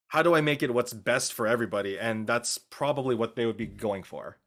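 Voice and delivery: ordinary talk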